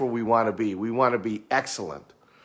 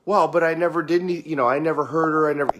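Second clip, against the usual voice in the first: fast, higher, louder mock protest; defensive client; 5 semitones higher, 4 dB louder, much faster than usual. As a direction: cut in like a husband who doesn't get it.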